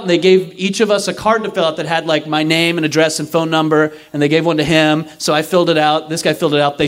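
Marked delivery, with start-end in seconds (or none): none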